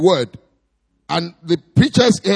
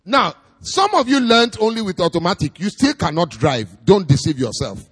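usual speaking voice

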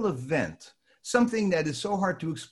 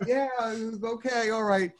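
mock placating; 10 semitones higher, slower than usual. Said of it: "I'd say".

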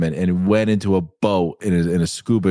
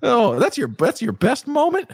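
normal talking voice

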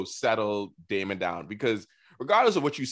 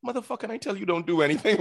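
fast, higher exasperated scold; half-joking imitation of Rhaenyra scolding; mock scolding; 8 semitones higher, faster than usual. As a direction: snap at him in a rush.

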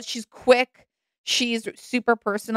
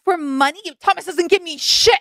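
higher, mocking sass; sassy impression; 8 semitones higher, 3 dB louder than usual. Imitating Catherine mocking the gold-digger claim with sass. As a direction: toss it back at him, scornful. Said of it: "She's like".

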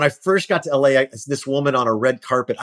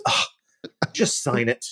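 impatient; 5 dB quieter, much slower than usual. Retold or imitated. Fan brushes him off impatiently.

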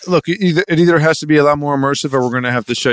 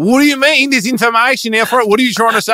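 put-on high, agitated Australian accent; pitched-up Australian protest; indignant Australian; 9 semitones higher, 3 dB louder than usual. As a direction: demand an answer like an exasperated Aussie. Australians bickering over meaning.

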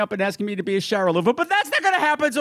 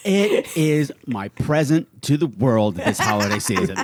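deep mock voice; 11 semitones lower than usual. Jokey deep gift announcement.

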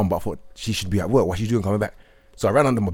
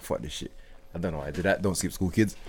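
quiet mutter; 6 semitones lower, 12 dB quieter than usual. Retold or imitated.

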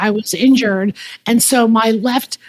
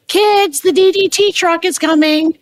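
high-pitched mock call to the kids; mom's announcing holler, retold in a high voice; imitated mother calling; 7 semitones higher than usual. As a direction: call out like a mom summoning children.

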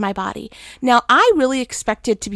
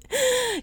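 high chuckle; 13 semitones higher, 5 dB quieter than usual. Retold or imitated. God chuckling at her.

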